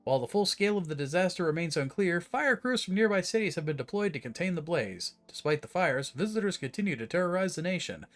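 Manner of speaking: steady emergency broadcast voice; calm official news announcement; news read. Acted. Reads emergency radio broadcast.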